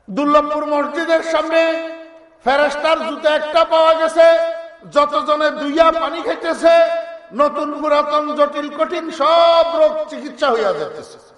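high, ringing proclamation; public announcement. Acted. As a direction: spread the miracle news like a town crier. Mosques announcing the miracle news.

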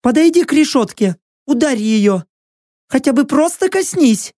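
high, insistent prisoner voice; pleading captive woman. A narrator's reading. Prisoner urging him to touch the bars, high pleading. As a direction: urge and plead at a high pitch.

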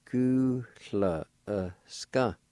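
"Gu la e ska" said at half speed; slow model. Shown as a demonstration. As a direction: say "Gu la e ska" slowly. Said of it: "Pronounce like this".

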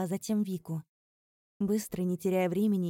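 plain reading voice

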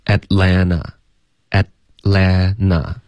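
Atlanta drawn out slowly as at-LAN-na, no T after the N; American dropped T. Shown as a demonstration. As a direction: break Atlanta into slow syllables, at-lan-na. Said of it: "那会读成什么呢". (Atlanta慢速示范，省略t音)

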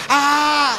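a sudden high, loud cry; startled yelp. Retold or imitated. Reliving the fart escaping, a startled yelp. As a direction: yelp as it slips out.

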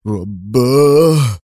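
long, drawn-out stunned رباه; stunned cry at the TV; shocked exclamation. A narrator's reading. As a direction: gasp it out in disbelief. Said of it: "هتف قدري".

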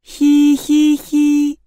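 high, slow monotone "hee-hee-hee"; eerie toy giggle. A narrator's reading. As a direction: giggle like a spooky toy, drawn out. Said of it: "безостановочно издавала Лолита". (жуткое протяжное хихиканье)

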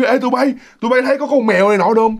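quick, high-pitched appeal to friends; acted urgent questioning. Scared girl asks roommates whether they saw a cat, urgent questioning.

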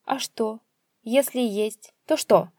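defiant question, rising in volume; father challenging his son. Acted. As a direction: dare him to answer. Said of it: "с вызовом спросил отец".

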